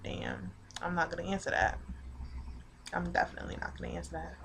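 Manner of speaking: very soft, under her breath; muttered aside. Retold or imitated. Privately reacting to being asked out, hushed.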